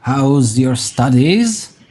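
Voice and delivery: lightly voiced old crone; a hint of a sly old woman. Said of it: "very, very old woman".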